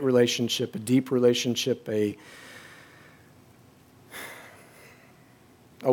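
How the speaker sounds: low, soft, with long pauses; quiet grief. Real, not acted. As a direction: drop into a subdued, mournful confession. Grieving son remembers his father.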